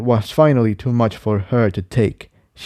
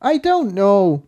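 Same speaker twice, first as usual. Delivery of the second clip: much higher, slow and unsure; Incendia unsure; 10 semitones higher than usual. Acted. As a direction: answer at a loss. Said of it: "Incendia responded".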